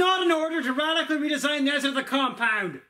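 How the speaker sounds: high, fast hammy boss scolding; villain boss voice. Pretend. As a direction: scold the minion like a cartoon villain. Minion Bits boss voice.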